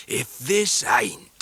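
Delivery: slow rough fisherman voice; a homely old sailor, deeply moved; Mr Peggotty. A narrator's reading. Mr Peggotty welcoming guests by fire, rough sailor voice.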